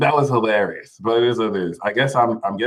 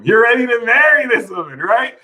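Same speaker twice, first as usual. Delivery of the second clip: much higher and louder; excited emphasis; 11 semitones higher, 4 dB louder than usual. Shown as a demonstration. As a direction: push it up with excitement.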